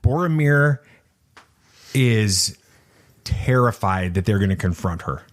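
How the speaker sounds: ordinary delivery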